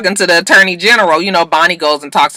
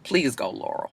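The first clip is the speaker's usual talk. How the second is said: quiet dismissal; 6 semitones lower, 12 dB quieter than usual. Retold or imitated.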